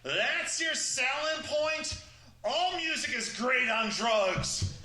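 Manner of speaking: mock outrage at a lame pitch; incredulous retort. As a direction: drag it out like it's absurd. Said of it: "And I'm like".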